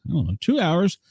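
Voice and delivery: usual speaking voice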